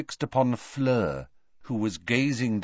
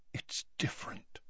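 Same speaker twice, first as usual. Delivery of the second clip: unvoiced, through barely moving lips; tense mutter; 16 dB quieter than usual. A narrator's reading. Lupin to Tonks, tense near-whisper. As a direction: barely move your lips. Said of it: "barely moving his lips".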